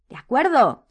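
high, loud concession; scared but determined. A narrator's reading. Hermione yields to her friends, scared but determined. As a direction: give in, frightened yet resolved. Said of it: "cedió ella por fin asustada pero decidida".

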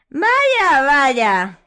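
very high, loud gloat; triumphant Umbridge. A narrator's reading. Umbridge gloating triumphantly over Hermione. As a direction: savour the win. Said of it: "exclamó ... triunfante".

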